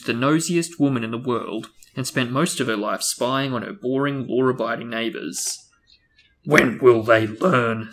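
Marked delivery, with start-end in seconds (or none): 6.4-7.9 slow, loud, blustering uncle voice; Uncle Vernon. A narrator's reading. Uncle fuming at the breakfast table, slow and loud. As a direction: bellow it like an outraged walrus of a man. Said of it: "said Uncle Vernon".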